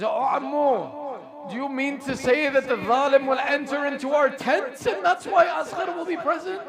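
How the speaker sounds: retold distressed questioning, climbing high at the end; mildly acted alarm, pitch rising; upset. Nephew questions uncle about enemies entering the tents, upset. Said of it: "suddenly becomes upset at this statement".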